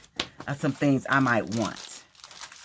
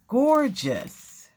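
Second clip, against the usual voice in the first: usual speaking voice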